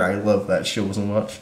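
ordinary talk

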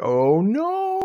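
high, swooping mock "oh no"; mock dismay. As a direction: imitate a heroine's dismay, sliding way up. Voices heroine finding slashed picture.

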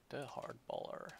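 ordinary talk, nothing marked

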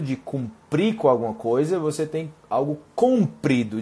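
ordinary talk

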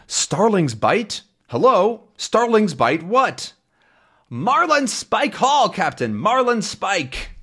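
high, slow, bewildered captain shouting into the phone; the old captain who can't hear a word, calling down the line; puzzled gruff sailor yelling hello; confused sea captain. Acted.

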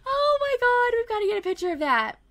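loud, high squeal over cuteness; real tickled squealing; delighted gushing. Real, not acted. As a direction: gush high and loud through real laughter. Delighted by toddler's face.

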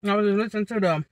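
speaking around a fork; words muffled by fork; talking with fork in mouth. Real, not acted. Seen 0: Fork held in her mouth by its handle while she talks.

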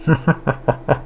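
quiet breathy chuckle; soft laugh. Real, not acted. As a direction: laugh softly at yourself. Camera froze, funny face.

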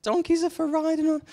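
very high, jeering crowd voice; hammed-up squeal of ridicule through laughter; mocking laughter. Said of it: "They're laughing at him, saying".

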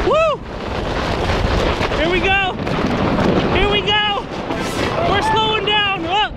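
high, loud cheering on the run; excited whoops. Real, not acted. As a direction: whoop all the way down the hill. Sledding off the jump.

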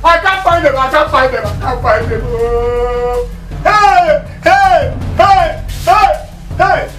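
loud, repeated cries sinking in pitch, then surging; panicked shouting. Acted. Man searching for his missing clothes, panicked shouting. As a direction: wail and bellow in panic. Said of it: "stop shouting".

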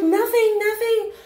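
very high, squeaky mocking imitation; put-on tiny high voice; backing-down bully. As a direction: mimic someone meekly taking it back.

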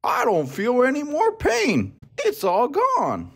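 high-pitched, drawn-out crocodile voice; sit up and announce relief in a storybook voice; relieved crocodile. A narrator's reading. Crocodile finding his toothache gone, high relieved voice. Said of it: "The crocodile sat up and said".

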